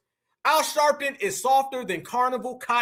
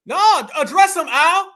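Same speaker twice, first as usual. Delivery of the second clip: raised, louder jeering call to Al; taunting call-out; 5 semitones higher, 7 dB louder, slower than usual. Real, not acted. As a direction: egg him on, loud and mocking. Taunting Al over the clip.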